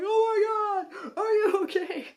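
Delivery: high, loud, slow alarmed mother; worried mom. Retold or imitated. Mom sees daughter fall, cries out alarmed. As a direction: cry out like a panicked mom. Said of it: "My mom was like".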